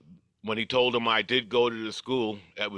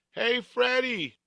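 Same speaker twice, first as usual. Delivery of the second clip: calling out; 13 semitones higher, slower than usual. Retold or imitated. Kids calling out to him.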